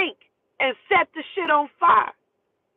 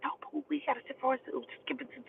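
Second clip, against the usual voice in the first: very soft, hurried imitation of her; hushed plea; 14 dB quieter, faster than usual. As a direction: plead fast under your breath.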